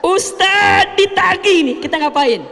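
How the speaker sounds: hammed-up shock at the debt collector; mock alarmed outburst. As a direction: cry out in mock panic. Preacher mocks being dunned.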